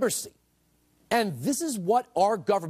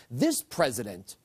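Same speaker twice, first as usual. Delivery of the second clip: ordinary delivery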